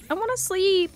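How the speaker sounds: I wanna sleep whined up high. Real, not acted. Tired player whining for sleep.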